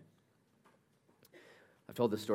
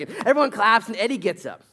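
ordinary delivery

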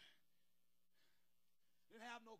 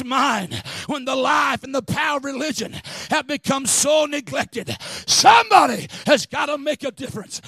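full-force preaching; 2 semitones higher, 9 dB louder than usual. Acted. Preacher rousing the congregation to stand up, full-force preaching. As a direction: preach it hard and build to the peak.

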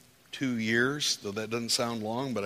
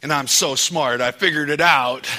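loud mock self-praise; booming ironic brag about himself; sarcastic; 4 semitones higher, 13 dB louder than usual. Real, not acted. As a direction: boast sarcastically at full volume. Sarcastic self-mockery to congregation.

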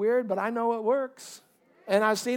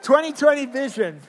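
higher, louder announcement of the title; loud proclamation; 4 semitones higher, 6 dB louder than usual. Real, not acted. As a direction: proclaim TWENTY TWENTY VISION to the whole church. Sermon title announced with force again.

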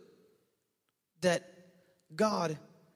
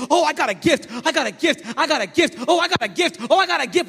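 high, loud giddy chant of a proud owner; mock giddy believer; 8 semitones higher, 11 dB louder than usual. Pastor mocking believers who just hold their gift, giddy high chant. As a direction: mimic someone clutching a present, thrilled.

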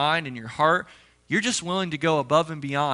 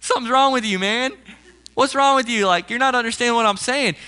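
higher and louder, a fed-up father scolding; a frustrated dad losing patience with his son; exasperated dad; 5 semitones higher, 4 dB louder than usual. Retold or imitated. Father scolding his four-year-old who asked for ice cream, exasperated and louder.

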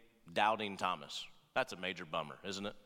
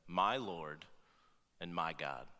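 much lower and softer, reverent; awed confession; 11 semitones lower, 5 dB quieter, slower than usual. Acted. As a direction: breathe it out in awe.

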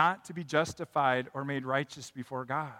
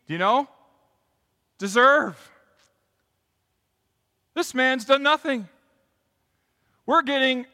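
rebuking criminal; 8 semitones higher, 9 dB louder than usual. Acted. Criminal on cross rebukes the mocker, loud and high. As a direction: scold him hard, near shouting.

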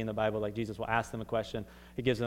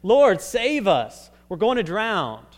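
loud, high cry for rescue; shouting; 7 semitones higher, 10 dB louder, slower than usual. Acted. Disciples waking Jesus as they fear drowning, shouting. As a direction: shout it out like a plea to be saved.

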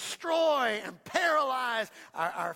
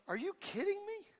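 incredulous David; 9 semitones higher, 7 dB quieter than usual. Acted. David confronts scared brothers.

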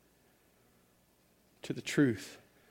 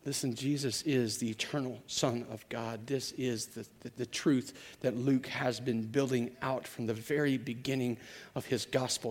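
normal talking voice

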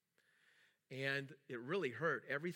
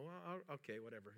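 mumbled, soft mock resignation; reluctant giving in; 9 dB quieter, faster than usual. Telling how he brushed off the acquaintance, quietly resigned. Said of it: "and I go".

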